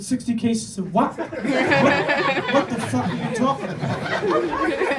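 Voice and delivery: mildly hammed-up bafflement, drawn out; baffled disbelief. Baffled nephew retelling his reply.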